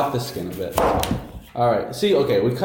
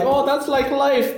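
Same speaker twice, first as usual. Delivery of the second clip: mocking singsong; 3 dB louder, slower than usual. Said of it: "And you're like".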